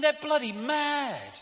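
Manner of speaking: high, slow scornful verdict. Real, not acted. Mocking royal protocol.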